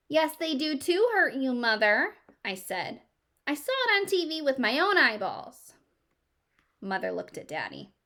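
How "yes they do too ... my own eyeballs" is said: put-on a higher, louder child voice insisting she saw it; a kid talking back to mom, pitched up high; indignant child. A daughter arguing with her mother about ponies, indignant and higher.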